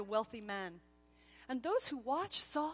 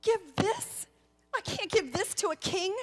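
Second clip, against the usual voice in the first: much higher, louder anxious plea; dismayed protest; 8 semitones higher, 7 dB louder than usual. Acted. Gasper begging not to give the hammer, dismayed. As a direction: recoil at the very idea. Gasper ashamed of his gift.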